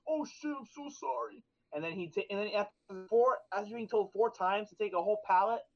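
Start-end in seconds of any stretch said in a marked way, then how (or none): 0.1-1.4 higher, slow, soft apology; the oblivious guy suddenly realizes; mock startled apology. Careless coworker realizes he dropped pallet, mock startled apology.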